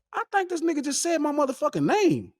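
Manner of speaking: high-pitched incredulous realization; retold astonishment. Friend hearing his name in the speech, retold astonishment. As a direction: build to the last word, astounded.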